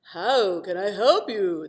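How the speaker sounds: shapeshifter voice. Acted. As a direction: offer help like a mysterious wizard. Shapeshifter character voice.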